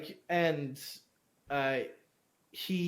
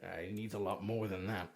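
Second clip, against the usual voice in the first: 1 muttered scorn; 10 semitones lower, 11 dB quieter than usual. Acted. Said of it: "He goes".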